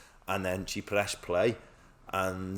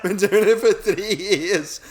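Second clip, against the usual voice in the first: laughing; 10 semitones higher, 11 dB louder, slower than usual. Retold or imitated. Retelling own exam excuse.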